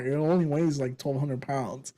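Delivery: usual speaking voice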